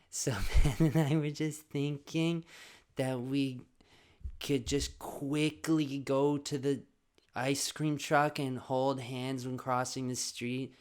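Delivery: drawn-out wheedling kid imitation; husky manipulative kid. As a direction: pitch a plan to mom very deliberately. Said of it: "he has a really husky voice".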